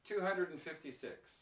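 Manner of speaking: "and" shrunk to a quick, weak "n"; natural reduced pronunciation. Shown as a demonstration. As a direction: run "hundred and fifty" together, "and" unstressed. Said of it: "can you hear this".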